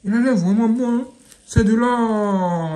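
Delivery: ordinary talk, nothing marked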